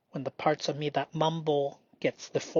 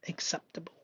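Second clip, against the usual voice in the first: whisper; 11 dB quieter, faster than usual. Real, not acted. Whispered word.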